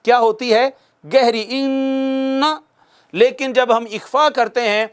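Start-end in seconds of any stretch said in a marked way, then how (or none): 1.5-2.4 ان held fully in the nose; full nasal hum on ان; deep nasal ghunna. Shown as a demonstration. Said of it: "purely ناک میں جاتی ہے اور گہری ہوتی ہے".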